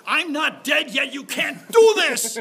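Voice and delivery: slow booming horror host shout. Acted. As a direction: roar it like a ghoulish host defending his turf. Horror host jokingly blocks newcomers.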